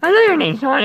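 high put-on voice; high playful character voice. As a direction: jump way up into the character's voice. Voicing game character.

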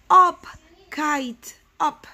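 punchy storybook shout, loud first word. Acted. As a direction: call out each word separately to the kite. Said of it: "and shout".

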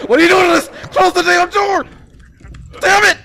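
panicked high scream; screaming in terror while fleeing; being chased. Real, not acted. Monster attacks, door left open.

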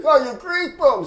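loud, high mock surprise; delighted discovery. Vendor finding out the photographer is Greek, high loud mock delight. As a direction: burst out in imitated recognition.

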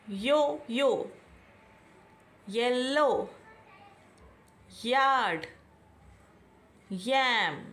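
यो-यो, येलो, यार्ड, यैम साफ़ य से शुरू करके; each word said slowly, Y as य; clear word demo. Shown as a demonstration. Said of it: "Y is in starting of each word". (हर शब्द की शुरुआत य की आवाज़ से, धीरे और साफ़)

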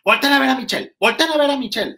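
high, loud urgent call to the cameras; high loud producer command; imitating the producer. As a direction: mimic the producer ordering everyone to look. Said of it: "y la productora".

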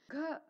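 க with a puff of air; aspirated k. Shown as a demonstration. Teacher testing air on the hand, க strongly aspirated. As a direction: puff breath out on க. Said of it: "ஏர் வந்து நம்ம கையில".

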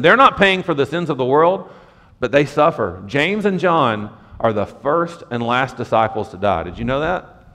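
normal talking voice

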